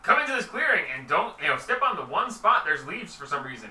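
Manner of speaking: high, loud mock invitation; mocking imitation. Game master mocking the leaf-covered trap plan, loud sarcastic lure. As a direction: lure them in with obvious fakery. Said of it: "He's like".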